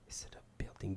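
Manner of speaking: whispered repeat. Retold or imitated. Driver whispering the question to himself. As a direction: hush it as an aside.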